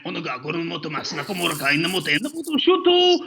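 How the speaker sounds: fast mock-Japanese call leaping high on the goal; Japanese-style play-by-play with a stretched goal cry; Japanese soccer commentary. Shown as a demonstration. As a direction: commentator exploding into a long goru. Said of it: "my favorite was Japan".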